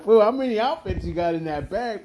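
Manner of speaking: high, exasperated demand; retold incredulity. Bouncer confronting his partner over outfit changes, incredulous. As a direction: challenge him with the pitch way up.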